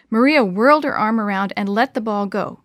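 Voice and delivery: ordinary delivery, nothing marked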